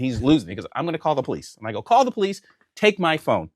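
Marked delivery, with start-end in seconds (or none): 1.8-3.5 higher-pitched goading dare; daring retort. Retold or imitated. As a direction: dare him, pitched up from the threat. His dare back to accuser.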